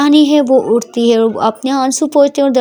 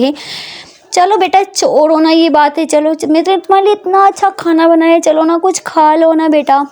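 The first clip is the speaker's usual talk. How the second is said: higher, soothing, coaxing mother; comforting mother; 4 semitones higher than usual. Acted. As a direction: coax her to stop crying and eat.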